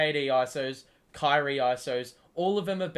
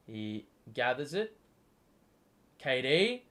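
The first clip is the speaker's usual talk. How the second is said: normal talking voice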